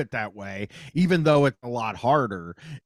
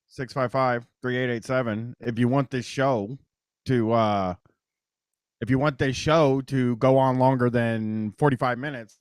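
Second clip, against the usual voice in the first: ordinary talk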